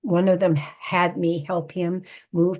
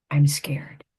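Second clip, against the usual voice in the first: a frightened whisper, barely voiced; scared whisper; 3 semitones lower than usual. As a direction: breathe it out like a scared old man. Frail father admits fear by phone.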